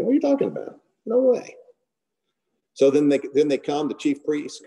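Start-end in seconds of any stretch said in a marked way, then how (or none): 0.0-1.6 high, incredulous protest of a retold Peter; indignant Peter. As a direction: Peter balks, pitched way up in disbelief. Peter denies Jesus's warning.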